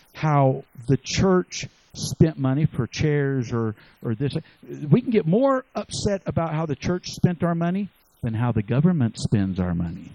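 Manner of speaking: ordinary talk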